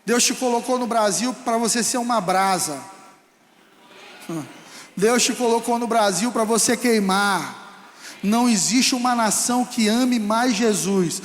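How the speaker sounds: usual speaking voice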